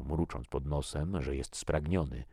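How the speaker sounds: ordinary delivery, nothing marked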